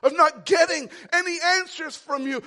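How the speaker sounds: loud, exasperated pleading with God; angry cry to God; frustrated prayer. Retold or imitated. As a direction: voice the weary believer's complaint. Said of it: "where you say".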